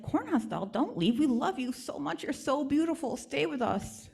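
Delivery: put-on little kids begging their friend not to go; pleading children. Village children begging the doll to stay, high and pleading.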